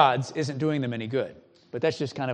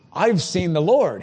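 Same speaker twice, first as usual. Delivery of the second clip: louder, higher, drawn-out acted alarm; mock panic; 4 semitones higher, 6 dB louder, slower than usual. Preacher acting out Gideon realizing he met God, mild panic. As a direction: act out a touch of awed panic. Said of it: "He's got this panic moment".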